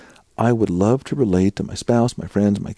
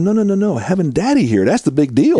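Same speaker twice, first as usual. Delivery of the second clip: a lightly voiced, higher, louder God insisting; God gently pleading with his child, pitched up; voicing God; 10 semitones higher, 4 dB louder than usual. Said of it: "God's saying".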